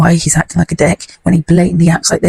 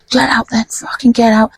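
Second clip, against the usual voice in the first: imitating angry man; 6 semitones higher than usual. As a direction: act out an irate order, pitched up. Man ordering family out, retold.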